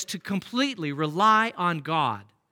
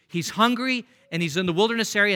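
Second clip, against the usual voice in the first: ordinary talk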